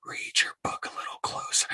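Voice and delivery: sharp unvoiced whisper; a whispered jab at hypocrites; whispered retort. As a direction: hiss the advice under your breath. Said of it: "I just wanna tell 'em".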